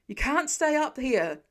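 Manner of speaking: slow, loud warning shout; fireman shouting. Acted. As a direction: shout him down off the sill. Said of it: "the fireman shouts".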